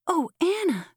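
put-on high-pitched child's cry; small girl, voice pitched well up; little Jane calling out. A narrator's reading. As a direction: call out in a little girl's high voice. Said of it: "cried little Jane".

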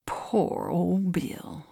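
very slow, soft lament; mournful exclamation. A narrator's reading. Givens over the dead lion, slow and mournful. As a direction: draw it out softly in mourning. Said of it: "he exclaimed mournfully".

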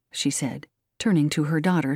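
plain reading voice

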